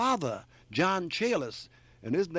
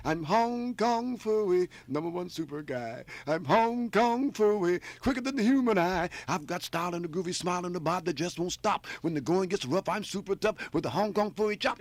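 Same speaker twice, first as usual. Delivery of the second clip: Hong Kong Phooey voice; 3 semitones higher, 5 dB louder than usual. Shown as a demonstration. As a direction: belt it like a vintage cartoon hero. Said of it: "I did the cartoon voice of, uh, Hong Kong Phooey".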